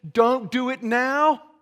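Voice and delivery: high, loud, drawn-out plea; loud mock pleading. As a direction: cry out the demons' desperate plea, slowly. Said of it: "they're pleading with him".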